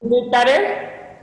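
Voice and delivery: hollow room reverb; cave echo. Real, not acted. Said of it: "it's like you're in a cave".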